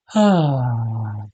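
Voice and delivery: loud falling sigh; breath released as a loud voiced sigh; audible sigh out. Shown as a demonstration. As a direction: let out a big open "ohh" on the exhale. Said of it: "let it out with a sound".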